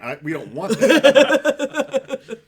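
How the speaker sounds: high, loud, slow laughing protest; laughing backpedal. Real, not acted. Firefighter laughing off his own joke, loud and slow. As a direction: laugh it off, stretching the words.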